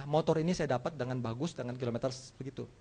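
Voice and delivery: ordinary talk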